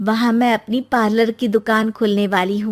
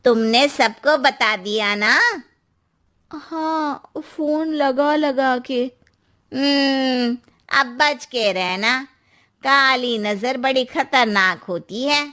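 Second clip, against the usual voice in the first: lightly voiced nosy aunty, a touch slow; a hint of a knowing aunty; gossipy aunty; slower than usual. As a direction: play the neighbourhood auntie warning her. Narrator voices Verma aunty.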